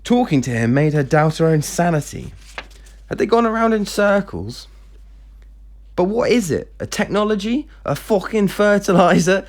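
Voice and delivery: high-pitched, incredulous demand; exasperated questioning. Acted. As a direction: demand an answer in disbelief. Exasperated daughter questions her father.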